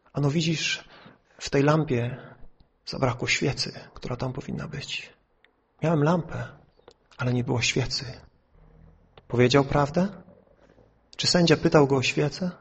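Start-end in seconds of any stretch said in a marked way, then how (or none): 0.1-8.0 low, soft retold admission; sheepish confession. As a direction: lightly act an evasive witness owning up quietly. Signalman confesses to his friend. (ciche, niskie, niechętne wyznanie)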